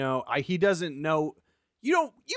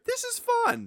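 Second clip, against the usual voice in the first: squeaky high fun; high mock cheer. Mock viewer reaction.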